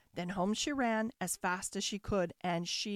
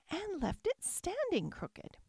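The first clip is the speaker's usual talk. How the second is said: higher-pitched mother bear voice; 9 semitones higher than usual. A narrator's reading. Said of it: "said the mother bear".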